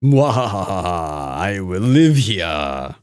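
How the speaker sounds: slow, low villain gloat; mad scientist. Pretend. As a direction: cackle then drawl it out slowly. Villain laugh, slow menace.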